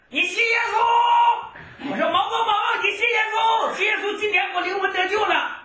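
high, loud street shout calling to condemned men; urgent high preaching shout; retold shouting. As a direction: shout it across the crowd. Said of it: "我就使劲喊".

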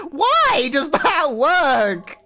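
high, loud, very drawn-out wail; stretched-out shout of bafflement; incredulous yell. Real, not acted. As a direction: yell it slowly in disbelief. Baffled that a fluke worked.

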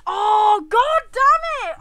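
high, loud, drawn-out curse; real anguished swearing; exasperated cry. Real, not acted. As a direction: wail it slowly in frustration. Cursing own mistake.

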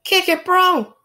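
excited cheer. Acted. Cheering friend on snowboard.

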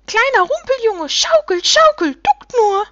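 very high, loud, gleeful cheering; jubilant little creatures. A narrator's reading. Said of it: "Da jubelten die Rumpelwichte".

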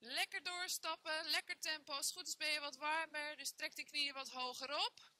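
ordinary delivery

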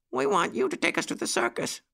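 high little puppy voice; pleading puppy. A narrator's reading. Jackie asks Uncle Wiggily to take them, high pleading voice. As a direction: beg in a high, small voice.

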